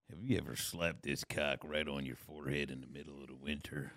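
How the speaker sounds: low, drawn cowboy truck-ad voice. Retold or imitated. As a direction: growl it like a rugged cowboy. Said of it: "I be hitting her with the cowboy voice too".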